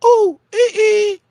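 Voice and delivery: put-on very high, loud baby squeal; mimicked baby babble. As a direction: imitate a baby's babbling in a piercing falsetto. Mimics the baby's babble from clip.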